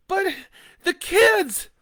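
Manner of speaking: high, loud desperate cry. Acted. Leah remembering her children, a high desperate cry. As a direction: scream for the children.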